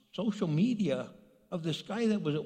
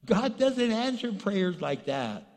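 usual speaking voice

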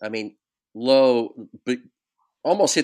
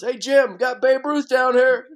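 much higher, louder ribbing holler; teasing call-out; 14 semitones higher, 4 dB louder than usual. Retold or imitated. Coach teasing the umpire behind the plate, a high loud holler. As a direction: rib the umpire across the field.

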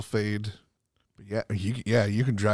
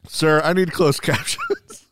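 higher, slow, laughing plea; laughing mock plea; 6 semitones higher, 5 dB louder, slower than usual. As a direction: beg the attendant for captions through laughter. Acting out the gas station plea.

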